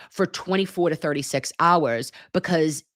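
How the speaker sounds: usual speaking voice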